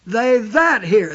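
"that" slowed and leaned on; stress on THAT. Shown as a demonstration. Preacher repeating the key phrase, stress on that. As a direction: press down on the word "that". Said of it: "The emphasis was on that".

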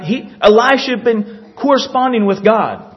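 ordinary talk, nothing marked

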